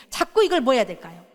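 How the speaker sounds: ordinary talk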